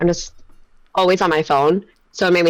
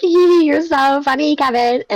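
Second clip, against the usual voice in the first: put-on high, slow giggly valley girl; 10 semitones higher than usual. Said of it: "Do I sound like a little valley girl laughing".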